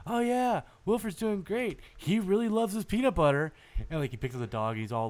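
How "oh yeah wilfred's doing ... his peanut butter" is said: high, falsely cheerful daycare guy; chirpy creepy dude. Retold or imitated. Creepy daycare man reporting to the owner, falsely cheerful. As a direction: sing it out sweet and creepy. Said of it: "and he's like".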